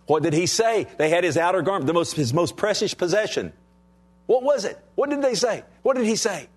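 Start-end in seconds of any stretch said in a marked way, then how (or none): 4.3-6.5 high, climbing rhetorical questions; rising preacher fervor. Real, not acted. Preacher pressing the congregation with high ringing questions.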